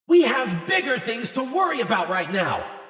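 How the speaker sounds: booming royal shout; Royal Canterlot voice. A narrator's reading. Twilight silences the bickering friends with a booming royal shout. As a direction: bellow it like a queen over the crowd. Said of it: "employ the Royal Canterlot voice".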